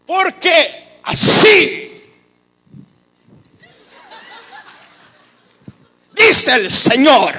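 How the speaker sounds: put-on booming, slow, old-testament prophet voice; mock prophetic show voice. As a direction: ham it up like a theatrical prophet, drawn out and loud. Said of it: "hacen como un show y todo".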